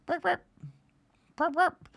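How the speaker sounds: high chirping imitation of a cricket; playful cricket noise mid-story. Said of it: "I hear a cricket".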